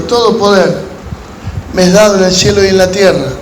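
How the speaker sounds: usual speaking voice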